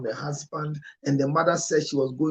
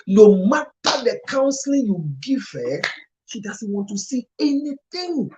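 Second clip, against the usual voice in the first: much louder and higher, pressing hard; impassioned; 6 semitones higher, 9 dB louder than usual. Real, not acted. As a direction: pour out the frustration loudly. Emotional outburst about the woman.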